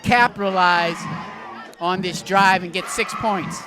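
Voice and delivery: excited touchdown call; play-by-play. Real, not acted. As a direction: call the score like an announcer.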